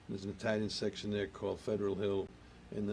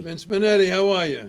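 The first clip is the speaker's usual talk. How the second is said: loud, high hello; loud, high greeting to a viewer on stream; excited greeting; 9 semitones higher, 14 dB louder than usual. Real, not acted. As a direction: boom out a welcome.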